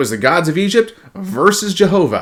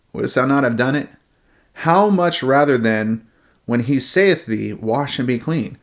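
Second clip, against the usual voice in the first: scripture reading; 3 semitones lower, 3 dB quieter than usual. Acted.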